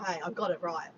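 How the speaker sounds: ordinary delivery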